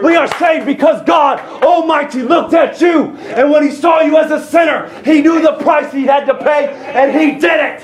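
high, shouted preacher's proclamation; full-force preaching. Real, not acted. Preacher declaring salvation by grace to the church, fervent shouting. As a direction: proclaim it at a sustained high pitch.

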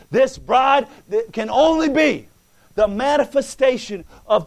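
loud, rising preacher's cadence, punching key words; full-force preaching. Acted. Preacher exhorting the church about the bride, full force. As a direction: preach it to the congregation. Preaching, slow emphatic build.

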